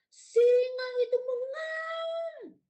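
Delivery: loud, high, drawn-out roar of narration; loud story voice. Shown as a demonstration. Teacher demonstrating the lion line for children, loud shout. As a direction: belt it out slowly like a lion's roar. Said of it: "keras dan lembut ya".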